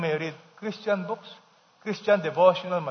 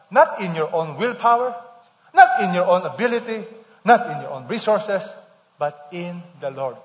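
full-force preaching; 6 dB louder than usual. Acted. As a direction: build the point to a proclaiming peak.

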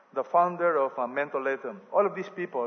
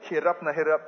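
usual speaking voice

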